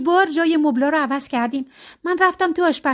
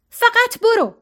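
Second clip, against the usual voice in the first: much higher, louder 'فقط برو', hitting 'فقط'; furious shout; 10 semitones higher, 4 dB louder, slower than usual. A narrator's reading. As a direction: shout them out of the room. Serena's final order.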